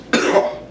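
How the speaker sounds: dry cough from dust; real cough from dusty air. Real, not acted. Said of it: "I'm just choking on dust".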